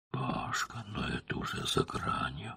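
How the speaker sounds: hushed whisper to her husband. Acted. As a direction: breathe it out softly. Katya whispering intimately.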